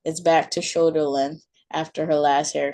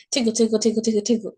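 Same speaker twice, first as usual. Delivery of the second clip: playful; 5 semitones higher, much faster than usual. Acted. Mother tickling her daughter.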